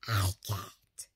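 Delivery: breathy whispered give-up; mock-defeated whisper. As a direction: whisper it like you're out of steam. Giving up, under breath.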